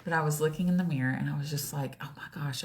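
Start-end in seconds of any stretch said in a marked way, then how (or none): 2.0-2.6 very soft, under the breath; a private gasp of dismay, barely voiced; hushed dismay. Dismayed private thought at mirror.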